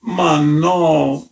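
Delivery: high, drawn-out plaintive wail; groaning, long-held denial; moaned. A narrator's reading. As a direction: moan it in distressed protest.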